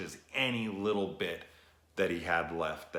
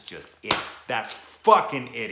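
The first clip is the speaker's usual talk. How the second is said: emphatic shout; 5 semitones higher, 12 dB louder than usual. Real, not acted. Vlogger hitting the climax of his retelling, loud. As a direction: slam the point home.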